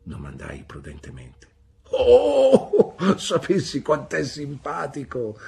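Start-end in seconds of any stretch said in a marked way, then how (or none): 1.9-5.4 high, loud delighted exclamation with a laugh; gushing cry of delight. Acted. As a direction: throw the arms up and gush. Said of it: "gridò Pigoti alzando le braccia".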